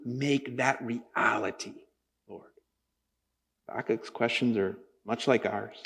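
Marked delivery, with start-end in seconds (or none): none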